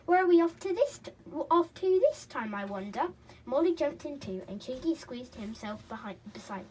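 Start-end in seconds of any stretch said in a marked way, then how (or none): 0.1-3.1 high and loud, quick and eager; a child bursting with curiosity; young boy's excited question. A narrator's reading. Child character voice.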